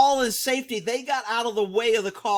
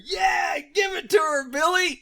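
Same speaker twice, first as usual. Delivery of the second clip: cheering shout; 7 semitones higher than usual. Retold or imitated. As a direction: cheer like a rowdy onlooker. Onlookers cheering the ram on.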